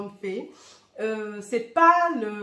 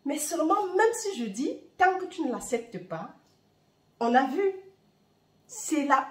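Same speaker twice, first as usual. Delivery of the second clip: ordinary talk, nothing marked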